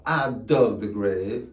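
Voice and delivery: plain reading voice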